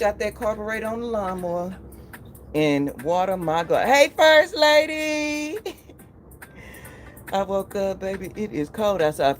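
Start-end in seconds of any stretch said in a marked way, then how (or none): 3.9-5.6 excited greeting. Real, not acted. Greeting a viewer in live chat.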